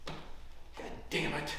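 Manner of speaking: soft, slow, breath-only swearing; muttered curse. Acted. Loser adding up the score, muttered frustration. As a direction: curse under your breath.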